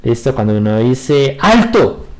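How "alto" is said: sharp stop command. Shown as a demonstration. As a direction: bark "Alto" so someone halts at once. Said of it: "indicándole a alguien que se detenga".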